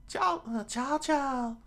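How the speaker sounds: very high falsetto gasped name; name the dog in a startled squeal; frightened townspeople. Acted. Mob halts in fear.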